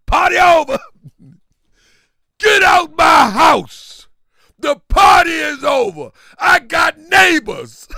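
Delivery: high, loud, drawn-out shout; mock angry yell. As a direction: yell at the guests to get out. Quoting angry host ending party.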